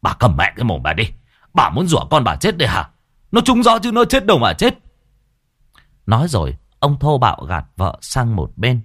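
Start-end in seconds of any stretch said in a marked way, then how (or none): 0.0-4.8 angry scolding. A narrator's reading. A husband angrily scolds his wailing wife. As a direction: snap at her to shut up.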